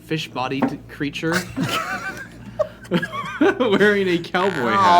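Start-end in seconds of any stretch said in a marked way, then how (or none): 1.4-3.5 high laugh. Real, not acted. GM laughs mid-description.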